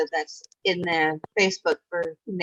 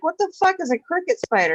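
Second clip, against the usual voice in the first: high, baffled retold question; incredulous; 11 semitones higher than usual. Retelling to friends, a high incredulous what-the-fuck. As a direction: ask it like you can't believe it. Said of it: "I'm like".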